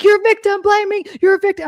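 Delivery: high, loud sneering mimic of accusers; mocking the critics. Vlogger mocking viewers who accuse her of victim blaming, high loud sneer. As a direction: whine it back like a scornful critic.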